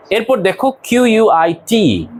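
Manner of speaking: ordinary talk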